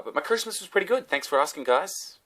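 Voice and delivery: fake-bright thank you; mock cheery. Vlogger thanking viewers, high mock cheer.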